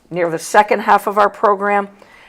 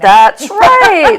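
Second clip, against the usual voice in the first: sharp high exclaim; emphatic exclamation; 13 semitones higher, 9 dB louder than usual. Real, not acted. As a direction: bark it out high and loud. Agreeing about screaming fans.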